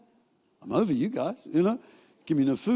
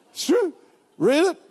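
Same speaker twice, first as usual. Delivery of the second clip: much higher, punchy and emphatic; excited insistence; 9 semitones higher, 3 dB louder than usual. Real, not acted. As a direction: sell it with delight.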